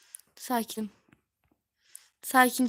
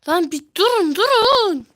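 shout; 7 semitones higher, 12 dB louder than usual. Real, not acted. Friends grabbing the controls.

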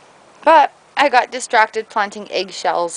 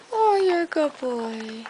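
higher, softer, slower praise; soft dog praise; 5 semitones higher, 5 dB quieter than usual. Real, not acted. Owner gently praising the dog after a biscuit, soft.

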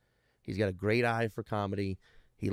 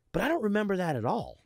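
innocent younger brother; 10 semitones higher than usual. Retold or imitated. Brother's reply quoted.

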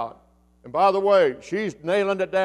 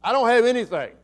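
contentious widow; 4 semitones higher, faster than usual. Acted. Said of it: "the tone of the voice?".

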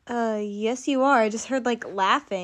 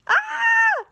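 much higher shrieking monster cry; monster roar; 4 dB louder than usual. Acted. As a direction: let out a sudden monster shriek. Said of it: "it's a monster".

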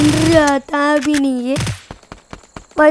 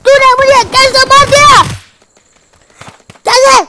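much higher, louder cry of outrage; furious shout; 9 semitones higher, 4 dB louder than usual. Real, not acted. Gamer yelling at the player who killed him, shout. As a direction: yell it at the killer.

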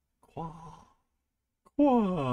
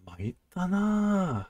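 mildly dismayed; 7 semitones higher, 3 dB quieter, slower than usual. Acted. Dismayed character line.

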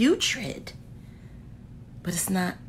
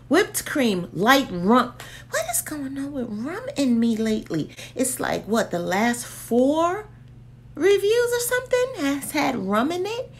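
ordinary talk, nothing marked

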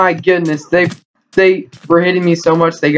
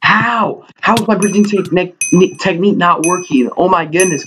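incredulous complaint; 6 semitones higher than usual. Real, not acted. Bridge fails, spawn shot.

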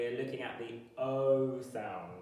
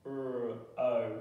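normal talking voice

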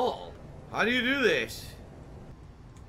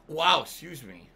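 ordinary talk

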